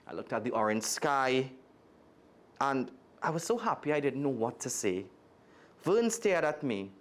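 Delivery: expressive read-aloud storytelling; lively storybook reading voice; story narration. A narrator's reading. Reads a children's story aloud.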